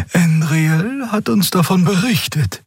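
high-pitched, unreadable character voice; noncommittal Xea voice. A narrator's reading. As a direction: say it high, giving no hint of belief. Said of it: "ohne erkenntlich zu machen, ob sie ihm glaubte".

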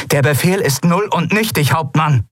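high, projected retort; calling out. A narrator's reading. Kalin countering the captain's arrest order, calling out. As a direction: call it out with authority. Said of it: "rief Kalin".

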